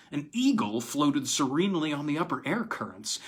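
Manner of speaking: ordinary delivery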